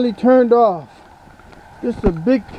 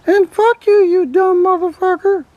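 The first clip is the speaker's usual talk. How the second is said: duck puppet voice; 8 semitones higher than usual. Acted. Ducky insults the owner.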